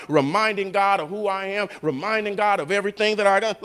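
ordinary talk, nothing marked

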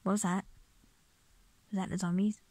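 ordinary talk

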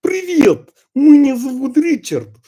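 loud, high, hammed-up Russian accent; caricature Russian accent. Shown as a demonstration. Language coach showing learners an over-the-top accent, caricature Russian. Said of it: "like caricature Russian".

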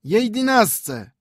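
very high, slow chime; little watches confirming eleven; tiny pocket watches. A narrator's reading. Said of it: "potwierdziła lilipucia gromadka zegarów".

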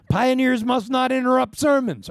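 high, loud mock rebuke; mock scolding. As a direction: tease her with a pretend scolding. Jokingly scolding a congregant.

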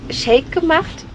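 usual speaking voice

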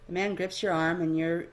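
ordinary delivery, nothing marked

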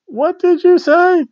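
very high, drawn-out squawk; mock outraged commentator. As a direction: squeal the question slowly in disbelief. Acting out commentators' banter.

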